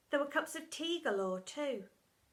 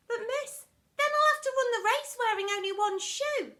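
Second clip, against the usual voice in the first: put-on much higher pleading child voice; whiny pupil; 12 semitones higher, 7 dB louder than usual. Pupil protesting to Miss Seeth, whiny. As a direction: whine it like a child.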